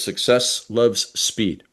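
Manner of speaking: slow, weighty slogan read; slow motto. Shown as a demonstration. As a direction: spell out the motto slowly, each word landing. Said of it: "As my shirt says".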